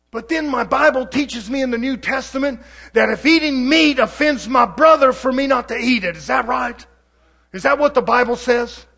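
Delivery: fiery preaching. Real, not acted. Preacher condemning worldly believers, loud and fervent. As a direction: preach it hard and hot.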